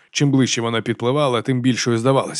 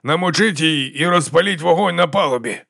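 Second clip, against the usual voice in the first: raised, higher commanding voice; roared order; 6 semitones higher than usual. A narrator's reading. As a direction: call orders to the captain. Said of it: "заревів Яков капітану".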